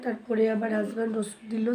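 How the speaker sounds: usual speaking voice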